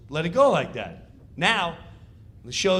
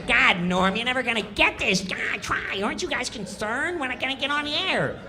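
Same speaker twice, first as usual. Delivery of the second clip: whiny Kattan; 7 semitones higher than usual. Retold or imitated. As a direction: nag him in a high whine. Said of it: "Kattan's going".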